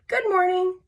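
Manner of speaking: high, singsong good-manners greeting; chirpy high hello; polite greeting. Acted. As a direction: greet politely, pitch lifted well up. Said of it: "So today I said".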